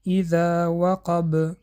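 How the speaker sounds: اذا وقب slow, with the final ب bounced hard; slow down and echo the ب at the end of وقب; strong qalqala stop. Shown as a demonstration. Teacher recites اذا وقب for students with strong qalqala at the pause.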